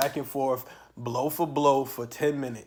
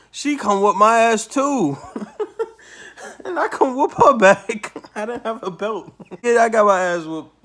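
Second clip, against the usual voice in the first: loud, high-pitched storytelling through laughter; really laughing, voice pushed high and loud; laughing outburst; 8 semitones higher, 10 dB louder than usual. Real, not acted. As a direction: crack up while telling the punchline.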